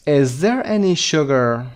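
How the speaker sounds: very slow, carefully spaced words; slow model reading. Shown as a demonstration. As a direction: read it out slowly for learners. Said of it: "teacher reads item slowly".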